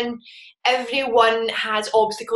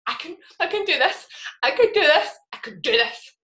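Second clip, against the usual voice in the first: tag; 5 semitones higher than usual. Retold or imitated. Still tearful, trying to repeat louder.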